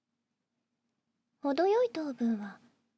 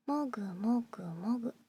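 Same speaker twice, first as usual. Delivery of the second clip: whispered chewing sound; 2 semitones lower than usual. Acted.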